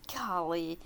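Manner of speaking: ordinary delivery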